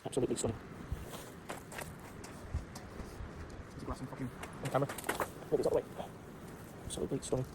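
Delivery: low, very soft and awed; hushed awe. Real, not acted. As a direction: whisper it in wonder at the top. Awed at the summit.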